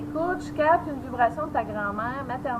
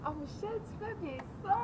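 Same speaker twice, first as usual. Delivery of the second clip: softer, breathy swoon; swooning hush; 4 semitones higher, 9 dB quieter than usual. Real, not acted. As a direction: melt as if charmed. Swooning at his voice.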